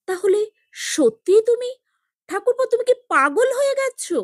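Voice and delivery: sister-in-law cries out at his madness, high and loud; shocked outcry. A narrator's reading. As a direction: shriek it at him. Parul shocked by the marriage news.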